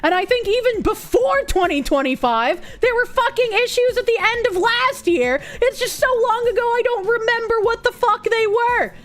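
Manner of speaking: exasperated furious ranting; crashing out. Real, not acted. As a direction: vent it all in a furious outburst. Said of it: "I'm crashing out!".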